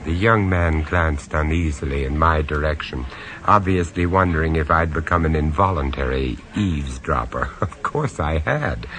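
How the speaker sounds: low-key, wry first-person narration; lightly amused storyteller; story narration. A narrator's reading. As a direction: confide it to the listener.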